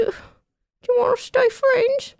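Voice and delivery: tiny high squeaky voice. Acted. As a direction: speak like a small trapped creature. Kevin trapped inside.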